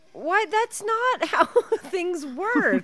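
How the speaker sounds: high, drawn-out incredulous complaint; high-pitched exasperation; exasperated protest. Real, not acted. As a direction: protest the nonsense in a high, stretched voice. Exasperated reaction to film's nonsense.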